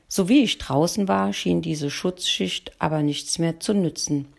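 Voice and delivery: ordinary delivery, nothing marked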